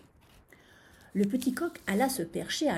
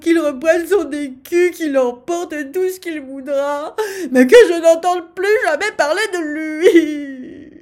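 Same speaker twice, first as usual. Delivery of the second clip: high, full-force frantic yell; howling; 8 semitones higher, 16 dB louder than usual. A narrator's reading. Stung sultan gives up his treasure, howling. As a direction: wail it at the top of the voice, stretching the ends. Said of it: "hurla-t-il".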